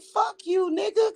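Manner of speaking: high shouted retort; retold high shout. Yelling back at Mike on the call, high shout. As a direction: fire it back at him, high and sharp. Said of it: "so I'm like".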